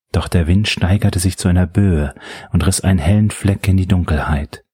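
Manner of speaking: plain reading voice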